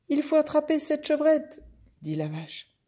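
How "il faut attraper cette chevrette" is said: high, urgent mother cow voice. Acted. Cow telling the horse to catch the goat, high urgent voice. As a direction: call it out high in mid-chase.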